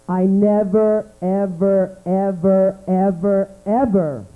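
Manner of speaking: ordinary talk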